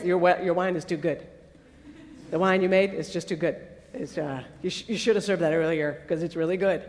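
sheepish critic. Acted. Wedding host complaining to Jesus that the wine is too good, sheepish. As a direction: awkwardly offer a backhanded compliment, quick and low.

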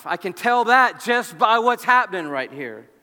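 ordinary talk

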